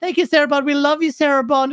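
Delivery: mock a gushing commenter; lightly mocked simp. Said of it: "you'd always have someone simp in the".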